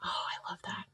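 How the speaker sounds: soft breathy whisper. Real, not acted. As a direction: whisper it under your breath. Whispered aside to herself.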